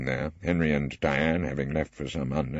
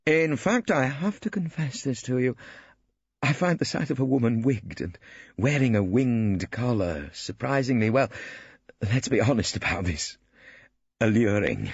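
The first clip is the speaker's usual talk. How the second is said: awkward stiff gent, understated; lightly voiced stiff bachelor; 5 semitones higher than usual. A narrator's reading. Ballard boasting of his flat to Phillida, lightly voiced.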